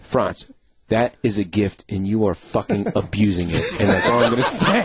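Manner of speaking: a low disapproving friend; imitating Deco. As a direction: scold him gravely. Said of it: "He goes".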